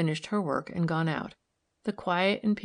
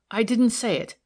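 louder, higher protest; crying out; 5 semitones higher, 6 dB louder than usual. A narrator's reading. Maid denies accusing anyone inside, crying out in protest. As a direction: cry out in denial. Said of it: "cried the woman".

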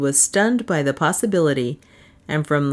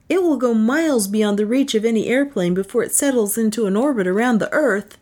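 higher, earnest inventor explaining; Pat the inventor; 7 semitones higher than usual. Acted. Pat explains to Mallory why the rod is out of reach, earnest higher voice. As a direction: lift the voice into Pat, patient and earnest. Pat's voice in narration.

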